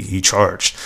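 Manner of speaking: solemn, hushed send-up of "charged"; mock serious. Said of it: "So serious".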